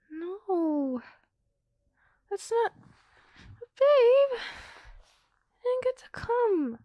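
high, loud, stretched-out whine of disappointment; frustrated whining. Acted. Girlfriend to boyfriend who came too fast, high loud whine. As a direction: wail it like a let-down girlfriend. Girlfriend upset she didn't come.

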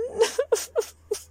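high wailing whimper; pained cry. Acted. Cries out to Daddy after the count, wailing.

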